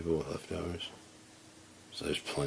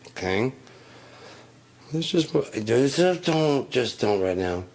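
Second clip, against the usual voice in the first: loud, higher, strained pleading; loud exasperated plea; pleading angry rant; 3 semitones higher, 3 dB louder than usual. Retold or imitated. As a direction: beg her to stop, barely holding it in. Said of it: "not my real mom".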